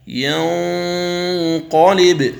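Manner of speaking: ينظرون drawn out with the nasal hum held; slow tajweed recitation. Shown as a demonstration. Teacher demonstrating ikhfa before ظ, slow recitation. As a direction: chant ينظرون slowly through the nose. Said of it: "قوله تعالى".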